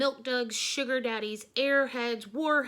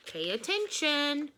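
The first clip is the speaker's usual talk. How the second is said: slow, high robotic command; computery robot voice; 6 semitones higher, slower than usual. Acted. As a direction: speak like a machine. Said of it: "in a computery voice".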